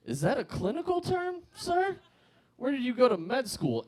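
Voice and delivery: high, incredulous mock outrage; indignant. Comic scolding the doctor, high and indignant. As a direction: scoff at the doctor in disbelief.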